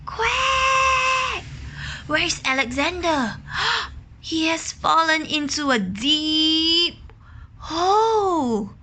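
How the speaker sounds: worried mother duck. Acted.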